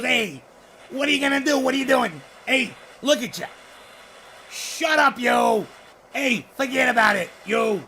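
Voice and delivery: a playful New Jersey wiseguy impression; New Jersey guy. Imitating an imagined New Jersey guy he'd love to podcast with, light New Jersey accent. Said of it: "and he talks just like this".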